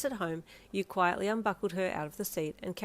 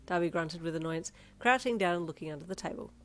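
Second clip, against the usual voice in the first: ordinary delivery, nothing marked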